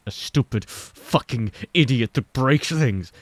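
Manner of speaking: bitter self-loathing. Acted. Self-hatred in narration.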